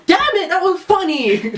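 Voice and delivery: high, slow, loud protest; mock exasperated exclaim. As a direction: insist the joke landed. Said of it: "I was like".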